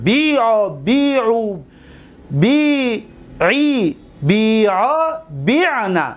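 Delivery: measured paradigm chant, long bii in بیعو and بیعی; conjugation recited. Shown as a demonstration. Teacher reciting the imperative forms, ya restored and long. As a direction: draw out each form separately, بیع, بیعو, بیعی.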